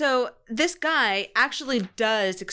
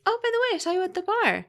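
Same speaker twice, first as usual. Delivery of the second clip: much higher, sing-song fake-friendly voice; mock chirpy; 8 semitones higher, faster than usual. Mocking Charlene chatting after being yelled at, fake-sweet. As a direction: chirp it like forced cheerful small talk.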